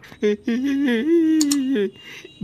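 triumphant villain laugh; smug victorious laugh; gloating laugh. Acted. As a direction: laugh at the ruin you caused.

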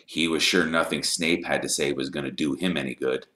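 ordinary delivery, nothing marked

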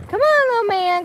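loud cheerful coaxing; coaxing call. Real, not acted. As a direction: urge the little dog on. Coaxing the dog along.

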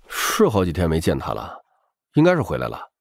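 usual speaking voice

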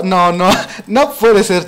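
slow, laughing no-no-no at the unfairness; slow, amused "no puede ser" with a laugh inside; laughing disbelief. Real, not acted. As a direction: laugh through the protest. Two players gang up on him.

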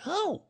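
very high, brief oh on landing; small bumped oh, pitched high; high short "oh". A narrator's reading. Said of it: "as he came down, he said".

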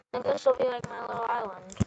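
usual speaking voice

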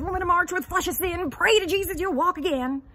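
high, loud hellfire preacher voice; ranting preacher. Retold or imitated. A stranger preaches at the wheelchair user in a high, loud preacher voice. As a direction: bellow it like a street preacher babbling about the devil. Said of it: "starts to babble about the devil".

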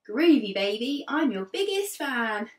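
put-on lively read-aloud for young children; teacher reading a picture book to her class; storybook narration. A narrator's reading. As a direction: tell it like a class story time.

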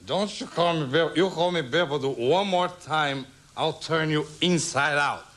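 mock-menacing warning, slowing at the end; threatening drunk. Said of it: "He says".

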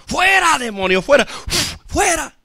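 high, sharp acted command; commanding exorcist cry. Peter driving out a demon, high commanding cry. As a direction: act out casting out a demon. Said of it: "Entonces ahí andaba Pedro".

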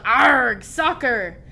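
loud growly ARG, then the word; hearty, hammed-up pirate holler; pirate yell. A narrator's reading. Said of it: "kicked the ball and yelled".

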